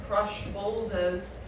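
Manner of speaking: ordinary delivery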